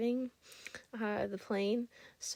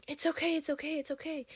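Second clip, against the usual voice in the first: higher, lightly put-on voice of a nervous flight attendant; imitating flustered guy; 7 semitones higher than usual. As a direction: play the guy brushing it off. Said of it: "one of the guys goes".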